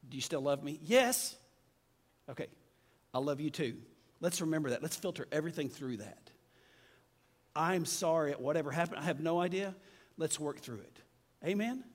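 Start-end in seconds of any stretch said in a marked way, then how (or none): 0.1-3.8 quiet, gentle reassurance between spouses; hushed and tender, mock dialogue; soft tender exchange. Husband and wife making up, softly tender. Retold couple's reconciliation. 7.6-11.0 quieter, gentle making-up; soft apology. Retold or imitated. Husband apologizing to his wife, softly sincere.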